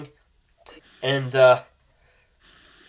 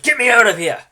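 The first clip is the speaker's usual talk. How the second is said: higher, louder desperate plea; frantic plea; 8 semitones higher, 8 dB louder than usual. Acted. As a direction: beg to be freed.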